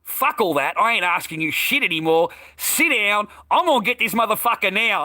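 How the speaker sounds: high, heated threat; retold yell. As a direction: reenact losing patience and taking over. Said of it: "I said, I yelled it".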